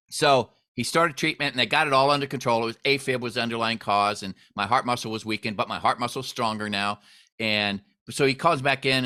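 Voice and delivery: usual speaking voice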